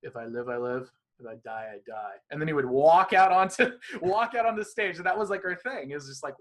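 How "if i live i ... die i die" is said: put-on light Russian accent, fatalistic; slight Russian accent, deadpan resignation. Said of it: "like, slight Russian accent too".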